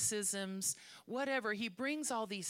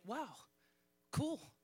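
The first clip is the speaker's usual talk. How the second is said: higher, eager and quick; excited Peter; 4 semitones higher than usual. Acted. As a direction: sound thrilled and ready to jump in. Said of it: "Peter's like".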